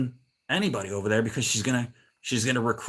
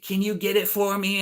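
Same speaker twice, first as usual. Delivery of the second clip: high put-on neighbor voice; imitating old lady; 8 semitones higher than usual. Old lady asks neighbor for an errand, mimicked high. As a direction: mimic her asking, pitched well up. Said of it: "she's like".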